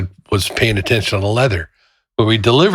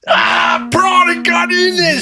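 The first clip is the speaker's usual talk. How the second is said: mock screaming; slower than usual. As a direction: scream it like a furious boss. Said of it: "screaming at me".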